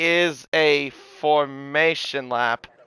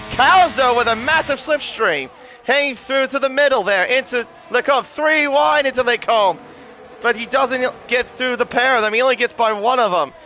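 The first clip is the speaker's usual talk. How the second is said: excited; 8 semitones higher than usual. Real, not acted. Three-wide overtake being called.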